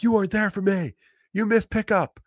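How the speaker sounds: put-on high, accusing child's voice; high wounded kid voice; whiny kid. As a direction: whine it like a hurt little kid. Kids voiced accusing dad.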